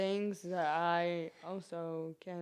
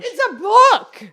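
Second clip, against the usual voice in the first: much higher and louder, slowed exclamation; excited exclaim; 11 semitones higher, 10 dB louder than usual. Real, not acted. Guest raves about the Cheesecake Factory menu, higher, louder. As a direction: marvel at how huge the menu is.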